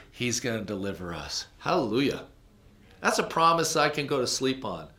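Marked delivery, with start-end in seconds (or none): none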